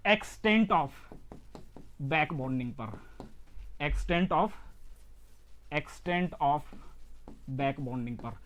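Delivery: broken into short chunks with long pauses; dictating. Real, not acted. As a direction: dictate softly, falling away at each chunk. Teacher dictating a phrase for notes. (रुक-रुक कर notes लिखवाते हुए)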